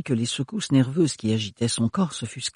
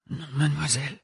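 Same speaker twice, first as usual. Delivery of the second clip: murmured reply; 2 semitones higher, 6 dB quieter than usual. Acted. Renard murmure un refus à Ophélie, presque inaudible. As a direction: murmur it so she can hardly hear. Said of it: "Renard avait murmuré une réponse à peine audible".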